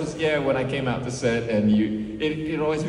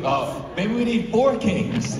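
playful proposal; 11 semitones higher, slower than usual. Acted. Joking proposal of band name.

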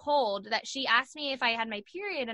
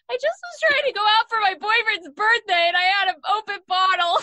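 high, louder mock-tearful whine; mock whiny cry; 10 semitones higher, 9 dB louder than usual. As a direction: play up your pathetic excuse with a fake sob. Her own whiny answer in jail.

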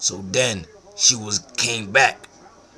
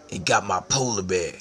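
usual speaking voice

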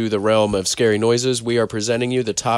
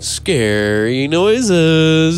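announcer voice; 5 semitones higher, 4 dB louder, much slower than usual. Acted. Announcing podcast title.

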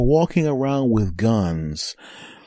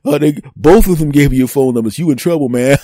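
louder laughing tease; guys teasing; 3 semitones higher, 7 dB louder than usual. Retold or imitated. The guys behind him mocking him, loud and laughing. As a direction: razz him through a laugh. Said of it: "They're like".

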